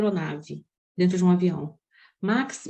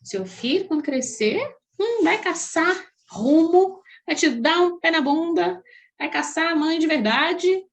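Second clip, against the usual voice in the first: higher, slow, knowing warning from onlookers; mock gossiping warning; 5 semitones higher, slower than usual. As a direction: drag it out like nosy people foretelling doom. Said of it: "Muitas pessoas falaram comigo assim". (aviso fofoqueiro, arrastado e mais agudo)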